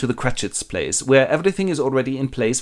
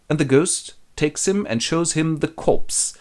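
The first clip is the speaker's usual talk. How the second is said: ordinary delivery, nothing marked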